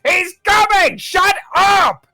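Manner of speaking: very high, loud warning yell; urgent shout. Acted. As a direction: yell back frantically on the run. Fleeing raider yells back.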